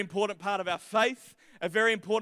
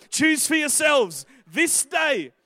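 slow, higher declamation in his own accent; own-accent proclamation; 4 semitones higher, 4 dB louder, much slower than usual. Real, not acted. Preacher redoes Joshua's challenge in his own accent, slowly proclaimed. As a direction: proclaim it slowly and firmly without the Scottish. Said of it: "I don't know why I went Scottish, but".

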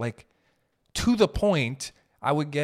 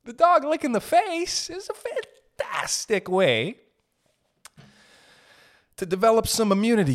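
mock expert; 6 semitones higher than usual.

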